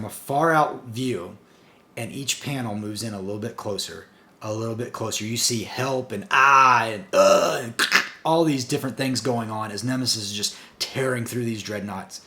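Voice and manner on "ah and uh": mock comic-book cries, louder than the narration; imitated screams. Reviewer reads out victims' cries as Nemesis attacks, mock screaming. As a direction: act out panel screams in short bursts.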